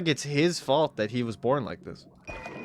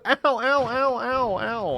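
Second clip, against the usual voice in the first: mock pained yelps; 8 semitones higher, slower than usual. Game character getting hit.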